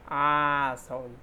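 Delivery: आ drawn out slowly and open; long aa demo. Shown as a demonstration. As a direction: hold the आ long. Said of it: "इसी तरह से वो होता है".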